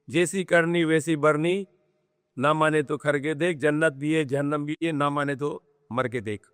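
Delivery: fast, rhythmic recital of an Urdu couplet; जल्दी-जल्दी कविता पढ़ना; poem recitation. Acted. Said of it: "we have a very beautiful poem".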